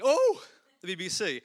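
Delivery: imitating a loud, eager gush; excited fan. Stranger hearing he works at the BBC, mock excited gush. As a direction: play the impressed stranger. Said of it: "you can see often a look of excitement".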